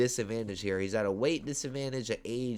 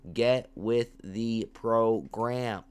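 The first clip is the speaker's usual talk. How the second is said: slow emphatic repeat; much slower than usual. Shown as a demonstration.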